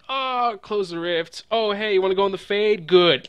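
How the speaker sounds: hammed-up cocky hero voice; mock casual swagger. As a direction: toss it off like it's nothing. Mocking the player's casual badass move.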